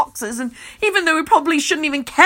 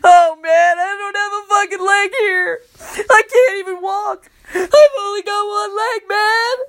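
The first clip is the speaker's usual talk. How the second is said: high, loud, crying wail; Kenny wailing over his lost leg; wailing like a toddler; 10 semitones higher, 4 dB louder, slower than usual. A narrator's reading. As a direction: bawl like a two-year-old. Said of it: "starts wailing like a two-year-old".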